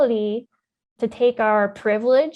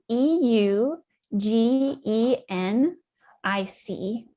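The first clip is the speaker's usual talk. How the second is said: slow letter-by-letter spelling; spelled out slowly; much slower than usual. Shown as a demonstration. Scientist spells a word for listeners, slowly letter by letter. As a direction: spell it out, pausing between groups of letters. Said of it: "Spell it for people who never heard it".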